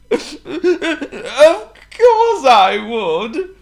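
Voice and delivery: very slow, high, loud blubbering; hammed-up bawling; crying king. A narrator's reading. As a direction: choke it out through sobs. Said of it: "cried the king".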